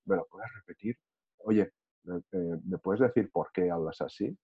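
soft, gentle reply to an angry person; speaking softly. Shown as a demonstration. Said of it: "Y hablas bajito".

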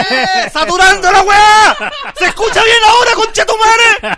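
mock screaming. Joking co-host screaming to saturate the audio. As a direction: scream to blow out the mic. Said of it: "Luchito chistecito".

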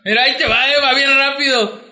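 hammed-up eager bravado; mock drunken showing off. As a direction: announce your amazing trick excitedly. Acts out drunk showing off punch.

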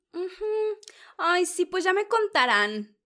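high, sugary mock agreement; a hammed-up high imitation of a gushing lady. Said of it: "Pegándole así a la mesa de que".

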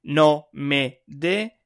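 drawn-out teacher demo, word by word; slow example reading. Shown as a demonstration. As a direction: slowly spell out the Spanish examples. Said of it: "like for example".

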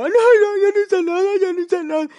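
high, loud mock wail; crying and screaming. Imitating his caught friend protesting innocence, screaming mock-tearful wail. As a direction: wail it out like a caught crybaby, hammed-up. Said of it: "Estaba grite y grite, llore y llore".

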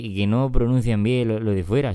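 ordinary delivery

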